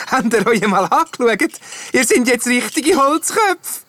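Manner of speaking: high, cackling wooden jester voice; loud laughing jester. A narrator's reading. Wooden jester mocking his changed friends, laughing loudly. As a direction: crow it loudly through laughter. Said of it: "laut an lachen und sagt".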